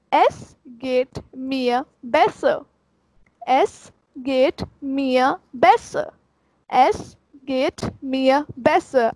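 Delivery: very slow, Es geht mir besser word by word; slow drill. Shown as a demonstration. Teacher drilling the phrase, very slow. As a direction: repeat it slowly three times. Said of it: "slow demo".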